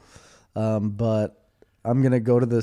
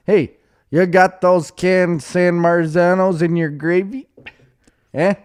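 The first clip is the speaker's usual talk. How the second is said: put-on Italian-American accent; 5 semitones higher, 5 dB louder than usual. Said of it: "I'll be saying".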